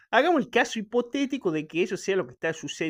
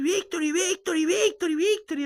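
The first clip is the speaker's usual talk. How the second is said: much higher, louder chanting of victory; imitated preacher shouting; 11 semitones higher, 5 dB louder than usual. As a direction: shout it like a TV spiritual guide whipping up a crowd. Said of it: "así grita".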